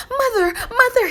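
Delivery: high, loud eager call; excited cry. Acted. Boy bursting into the hut to tell his mother, excited cry. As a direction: burst in calling out with joy. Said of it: "he cried".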